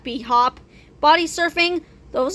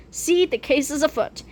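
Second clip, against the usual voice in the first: usual speaking voice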